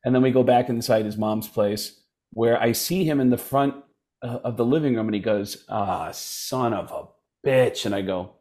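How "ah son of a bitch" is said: low, slow, soft groan of dismay; dismayed mutter. Retold or imitated. Friend realizes the cops are outside, low dismayed mutter. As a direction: draw it out low, under the breath.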